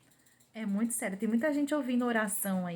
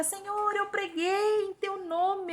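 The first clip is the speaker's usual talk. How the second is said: high, slow pleading voice; pleading sinner; 11 semitones higher, slower than usual. Retold or imitated. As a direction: beg the Lord to be recognized. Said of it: "as pessoas vão chegar". (pecador suplicante, agudo e lento)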